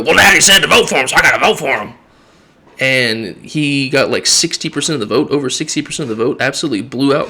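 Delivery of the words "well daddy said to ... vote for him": mock dim voter. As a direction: play a sheep obeying orders. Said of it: "get out there and go".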